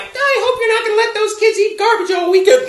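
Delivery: very high mock wife voice; nagging ex-wife. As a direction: nag in a put-on, high-pitched voice. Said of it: "the wife says something like".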